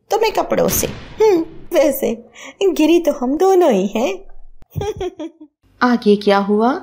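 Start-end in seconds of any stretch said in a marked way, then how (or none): 0.1-4.1 higher, smug and teasing; sassy comeback. Acted. Daughter-in-law teases her mother-in-law with a witty comeback, higher and smug. 4.7-5.3 smug laugh. Acted. Laugh after comeback.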